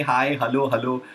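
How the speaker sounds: usual speaking voice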